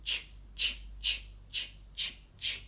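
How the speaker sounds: Ch, ch repeated as short breathy train puffs; chugging ch sounds. Shown as a demonstration. Teacher showing children the chugging ch sound. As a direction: puff out ch, ch like a steam train. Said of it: "make that action as we say the sound".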